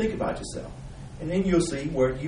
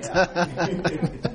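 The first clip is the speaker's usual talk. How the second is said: high burst of laughter; 7 semitones higher than usual. Real, not acted. Laughing at "lifetime" answer.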